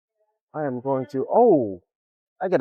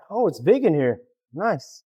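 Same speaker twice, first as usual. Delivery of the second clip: ordinary delivery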